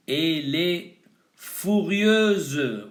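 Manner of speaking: "furieuse" stretched out slowly for learners; a teacher slowing down a new word for the class; slow demo. Shown as a demonstration. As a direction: spell out "Elle est furieuse" slowly, syllable by syllable. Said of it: "un adjetivo".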